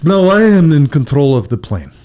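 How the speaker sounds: slow, hammed-up Russian villain; a hint of a Russian terrorist; mock Russian accent. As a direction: drag it out in a fake Russian accent. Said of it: "he's like".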